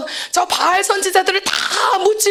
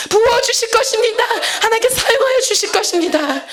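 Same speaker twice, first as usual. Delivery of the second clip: preaching at full force; 4 semitones higher than usual. Acted. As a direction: cry out the promise of revival to the nations. Preaching climax with crying. (울먹이며 힘차게 외치는 설교)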